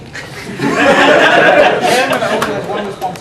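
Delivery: a warm open laugh after teasing; real laugh. Real, not acted. Speaker laughing at the angry-looking listener, open laugh. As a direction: laugh it off good-naturedly.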